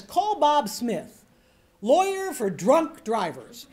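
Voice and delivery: slow, loud billboard read. Shown as a demonstration. Coach reading a billboard for students, slow and emphatic. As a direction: punch out each phrase of the sign, slowly. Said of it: "Read the thing out loud".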